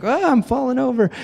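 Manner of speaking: high, comic mock alarm; toppling crown wearer. Crown wearer tipping under the giant crown, mock panicked. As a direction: wobble over in a high, playful yelp. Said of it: "if they turn a little bit, it's like".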